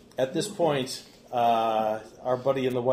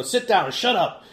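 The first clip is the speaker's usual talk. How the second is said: loud, curt command; threatening order; 8 semitones higher, 7 dB louder than usual. Acted. Servant threatens Froman with a crossbow, threatening order. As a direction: order someone into silence. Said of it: "threatens him with a".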